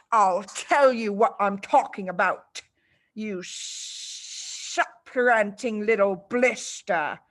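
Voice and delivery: slow, sinister hiss then snarl; menacing headmistress. Acted. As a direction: lean in with venom. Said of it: "the Trunchbull shouted".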